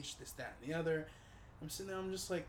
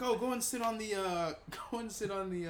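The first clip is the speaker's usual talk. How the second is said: imitating the doctor; 7 semitones higher, 6 dB louder than usual. Doctor voiced in retold story.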